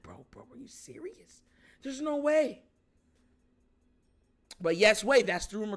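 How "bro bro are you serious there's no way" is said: stunned whisper. Retold or imitated. Disbelief reading the rumor.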